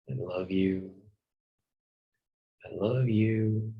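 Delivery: ordinary delivery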